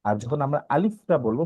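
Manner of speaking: ordinary delivery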